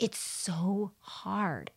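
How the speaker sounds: slow, soft, drawn-out whine; imitating a patient groaning about meditating; whiny patient. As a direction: drag it out like a complaining patient. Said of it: "And they're like".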